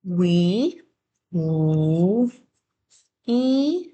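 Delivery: We sounded w- then e; sounding out words. Shown as a demonstration. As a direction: break We into sounds. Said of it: "try writing".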